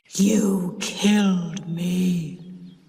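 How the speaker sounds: slow, heavy ghost voice; deep terrible voice. Acted. Sis posing as the dead husband, slow menacing ghost voice. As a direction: drag each word out like a vengeful ghost. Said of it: "in a deep, terrible voice".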